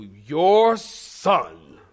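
cold, clipped "Your son" with disdain; disdainful. Retold or imitated. Wife at husband home from work, disdainful. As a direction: say "Your son" like it's his fault. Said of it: "she would always say it with such disdain".

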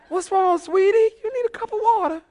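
very high, cooing falsetto; mock-concerned question. Preacher acting his question to the yelling woman, mock concern. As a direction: ask it in a squeaky, sweet little voice. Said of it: "I wanted to ask her if she needed".

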